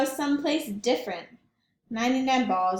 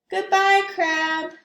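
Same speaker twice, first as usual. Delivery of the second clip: higher, louder call of farewell; fond goodbye; 7 semitones higher, 6 dB louder than usual. Acted. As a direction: wave goodbye across the water. Penguin farewell.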